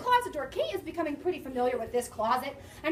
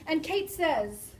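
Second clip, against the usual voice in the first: usual speaking voice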